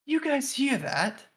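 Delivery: Sildar's questioning voice. Acted. Sildar to the party halfway down the tunnel, questioning. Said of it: "and he says".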